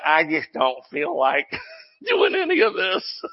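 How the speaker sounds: mock whine breaking into laughter; laughing. Preacher voicing the reluctant churchgoer, laughing. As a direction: laugh through a mock complaint. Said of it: "But I'm just laughing".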